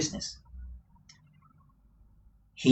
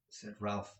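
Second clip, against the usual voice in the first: usual speaking voice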